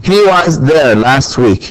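slow, deliberate model sentence; slow example sentence. Shown as a demonstration. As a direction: read it slowly for learners. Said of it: "نقول I was".

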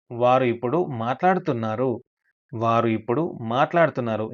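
normal talking voice

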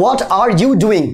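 "What are you doing?" slow, each word separate; slow full form. Shown as a demonstration. Teacher shows the uncontracted question. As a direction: spell it out word by word. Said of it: "যেমন আমরা বলি".

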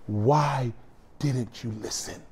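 soft, low, halting question; hushed divine disappointment; sorrowful reproach. Retold or imitated. Grieving Lord questions the damned.

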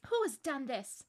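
put-on upset girl's outburst; distressed cry. A narrator's reading. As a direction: cry out, demanding who is to blame. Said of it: "cried Susan".

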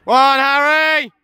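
drawn-out praising yell; loud cheer. Real, not acted. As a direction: call it out slowly. Praising keeper loudly.

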